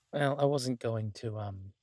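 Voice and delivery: usual speaking voice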